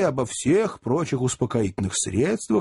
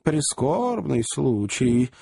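fussy little lawyer; 9 semitones higher than usual. A narrator's reading. Little lawyer counsels compromise. (деликатный поверенный, высоко и неторопливо)

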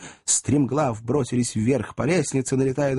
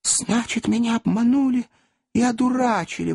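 exclaiming; 12 semitones higher than usual. Acted. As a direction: burst out, feeling cheated. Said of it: "воскликнул мистер Пиквик". (возмущённое восклицание)

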